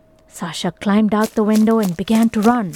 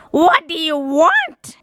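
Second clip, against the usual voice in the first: witch voice; 8 semitones higher, 4 dB louder, slower than usual. A narrator's reading. Witch snaps at the window.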